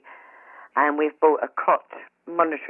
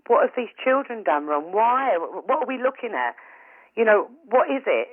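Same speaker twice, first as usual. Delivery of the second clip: higher, pleading cry of why; a mother begging the other mother for an answer, higher and pleading; distressed pleading; 7 semitones higher than usual. Retold or imitated. Retelling her plea to the mother on the ward, distressed and higher.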